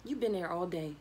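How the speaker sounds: usual speaking voice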